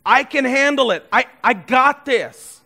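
proud voice insisting I can handle it; cocky boast. Pretend. Prideful phrase repeated loud.

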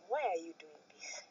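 soft, low retold plea; not raising voice. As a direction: replay a quiet, unraised question. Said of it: "I'm talking so slow".

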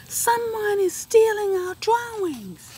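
high, loud alarmed cry. Acted. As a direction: raise the alarm. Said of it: "said the chalk".

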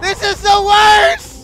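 slow high wail of frustration; exasperated high yell; loud. Real, not acted. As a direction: moan it out loud. Monkeys hurt, frustrated.

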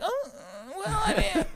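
high, squeaky hemming and hawing; obnoxious hedging. Acted. Mimicking people torn between yes and no, obnoxious. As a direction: stall in a silly high voice. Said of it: "is that obnoxious when somebody's like".

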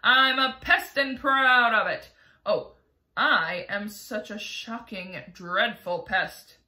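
loud, slowing gleeful boast; proud pest. Acted. Centipede boasts to everyone, loud and gleeful. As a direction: revel in being a pest, slowing down.

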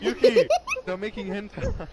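laughing shriek at a friend; laughing scold. Real, not acted. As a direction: cackle out the telling-off.

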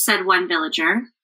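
ordinary delivery, nothing marked

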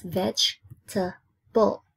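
VEGETABLE slowly split into vej, ta, ble; syllable by syllable. Shown as a demonstration. Teacher counting three syllables for pupils, syllable by syllable. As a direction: break "vegetable" into three slow beats. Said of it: "So three syllables".

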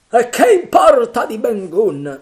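high, loud scornful shout. Acted. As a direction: yell it dismissively. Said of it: "gridò Mary".

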